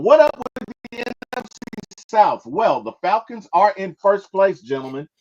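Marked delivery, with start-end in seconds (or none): 0.0-2.5 hyped segment intro; announcer voice. Acted. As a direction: call it out like a show announcer.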